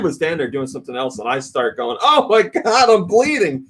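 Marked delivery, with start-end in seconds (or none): 2.0-3.7 high-pitched cry of alarm; hammed-up panicked wail; retold panic. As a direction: call out in acted horror. Retold panic to his dad.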